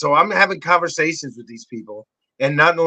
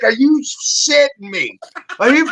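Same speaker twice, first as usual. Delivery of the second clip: incredulous; 7 semitones higher than usual. Retold or imitated. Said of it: "She's like".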